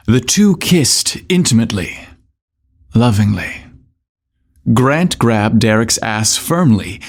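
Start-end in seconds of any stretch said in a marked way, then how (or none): none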